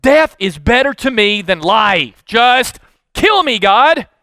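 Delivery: cried out. Acted. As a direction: beg God to end it, voice rising. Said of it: "And he cried out".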